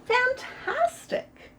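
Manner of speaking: a high, slow drawn-out fantastic; mock praise. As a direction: gush over him to trick him. Princess praises dragon, drawn out.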